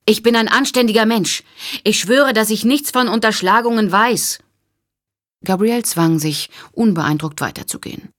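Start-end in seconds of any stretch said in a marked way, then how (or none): 0.0-4.4 high, loud pleading call; desperate shout. A narrator's reading. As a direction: shout after her, desperate to be believed. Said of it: "rief Harper".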